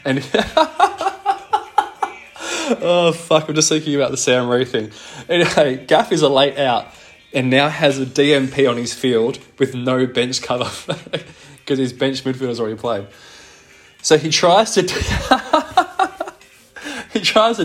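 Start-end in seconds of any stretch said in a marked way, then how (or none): 0.2-2.1 squeaky high burst of laughter. Real, not acted. Cracking up while retelling the trade glitch, squeaky laugh. 15.0-16.3 sudden high laughing burst. Real, not acted. Retelling the failed delist, high giggle burst. Laughs mid-sentence at the blunder.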